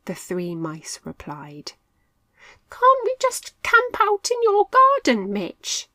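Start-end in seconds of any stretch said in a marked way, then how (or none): 2.7-5.9 much higher, squeaky begging mouse; pleading. A narrator's reading. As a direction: plead to stay safe at home. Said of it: "Milo pleaded".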